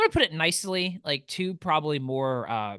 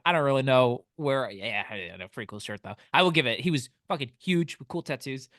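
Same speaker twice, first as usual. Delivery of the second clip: ordinary talk, nothing marked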